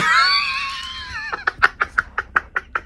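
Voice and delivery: high shrieking burst of laughter. Real, not acted. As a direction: let out a squealing laugh.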